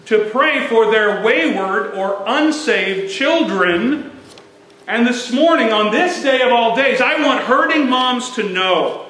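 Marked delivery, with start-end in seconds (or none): 4.9-9.1 higher, louder preacher's appeal; fervent preaching. Acted. Pastor urging hurting mothers on Mother's Day, fervent preaching. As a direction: lift it up and press it home to the church.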